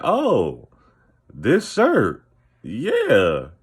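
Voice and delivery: swooping pitch, feigned approval with awkward gaps; drawn-out, unconvinced mock politeness; mock polite puzzlement. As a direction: imitate people straining for a compliment. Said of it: "trying to make sense of it rather than compliment".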